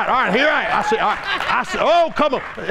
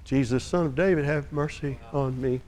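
low, soft, careful not to be heard; timid whispered-style plea; meek murmur; 7 semitones lower, 8 dB quieter than usual. Retold or imitated. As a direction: pray without drawing attention. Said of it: "I don't wanna draw any attention here".

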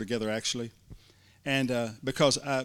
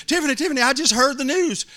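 much higher and louder, breathless joy; excited news; 12 semitones higher, 8 dB louder than usual. Retold or imitated. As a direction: call her name bursting with news. Pastor bursts in excited.

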